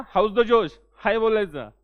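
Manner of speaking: loud, punchy How's the josh call; rallying shout. Shown as a demonstration. Teacher firing up police recruits, loud rallying shout. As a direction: shout it out to fire up the class. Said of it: "आपलं confidence पाहिजे एकदम जोरदार".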